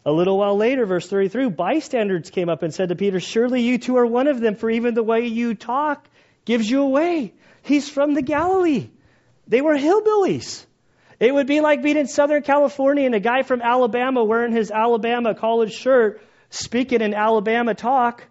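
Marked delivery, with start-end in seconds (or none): 3.2-7.3 accusing bystanders. Retold or imitated. 7.6-18.2 loud, high, driving sermon voice; fiery preaching. Acted. Preacher pressing the congregation, loud and high.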